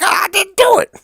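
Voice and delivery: hammed-up high whining protest; whiny mock denial. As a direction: wail the denial like a caught kid. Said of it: "And Petey's like".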